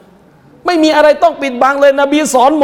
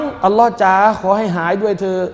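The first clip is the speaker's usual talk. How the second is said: hushed prayer; 4 semitones lower, 4 dB quieter than usual. Retold or imitated. Sick villager praying to be healed, softly pleading. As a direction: murmur a hopeful plea to God. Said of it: "ก็หวัง".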